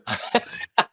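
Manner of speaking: airy unvoiced giggle; real amused laugh; breathy high laugh. Real, not acted. Laughs at own joke.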